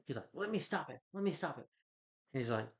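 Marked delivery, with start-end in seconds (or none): 0.3-1.7 high, softly swooping plea; mock pleading. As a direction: imitate someone begging, pitched right up. Same retold plea, repeated.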